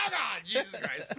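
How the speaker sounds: high, slow frightened shout; mock fright. Voicing the girl frightened by the man rising from the water. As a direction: yelp like she saw a ghost.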